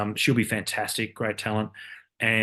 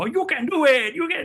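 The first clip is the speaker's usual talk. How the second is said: hammed-up Spanish accent, much higher; mock Spanish accent; 6 dB louder than usual. Colleague [name] joking on air, hammed-up mock Spanish accent. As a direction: imitate a comic Spanish accent guy from the movies, way up high. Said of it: "he started going fully Spanish".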